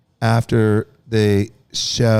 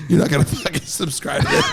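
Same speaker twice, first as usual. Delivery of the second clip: usual speaking voice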